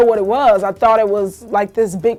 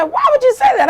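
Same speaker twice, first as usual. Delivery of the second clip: loud, high-pitched retold outrage; pissed mother; 6 dB louder than usual. Mother confronting her comedian daughter over a joke, pissed. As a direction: imitate mom's indignant outburst. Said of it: "my mother was so pissed".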